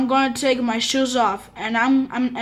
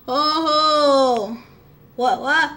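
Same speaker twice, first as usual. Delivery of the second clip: normal talking voice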